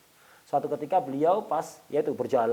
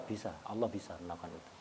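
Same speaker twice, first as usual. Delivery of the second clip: much softer, hushed answer; humble quiet reply; 7 semitones lower, 12 dB quieter than usual. Retold or imitated. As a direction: give a soft, humble yes. (jawaban lirih penuh tawadhu)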